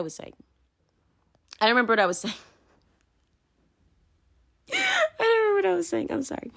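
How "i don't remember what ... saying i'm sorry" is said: high, squeaky words through laughter; helpless laughter. Real, not acted. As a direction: apologise while cracking up, trailing off softly. Cracked up after losing her thread.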